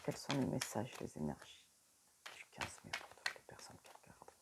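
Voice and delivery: breathy whisper to the cards; whispered invocation. Real, not acted. Reader whispers her request to the oracle. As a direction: murmur the request and thanks like a prayer.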